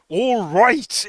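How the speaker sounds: a hint of a broad exasperated Irish accent. Imitating the chief's stressed broad Irish outburst. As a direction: mildly imitate an exasperated Irish "All right". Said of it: "in just the broadest, weirdest Irish accent".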